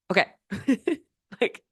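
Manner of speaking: laughing through the words, pitch up; can't help laughing while telling it; real laughter. Real, not acted. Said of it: "I'm laughing because".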